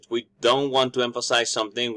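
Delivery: ordinary talk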